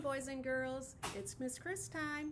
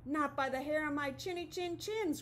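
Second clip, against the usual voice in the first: frightened pigs crying; 4 semitones higher than usual. Acted. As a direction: cry it out bravely but scared. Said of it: "cried the pigs".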